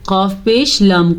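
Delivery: ordinary talk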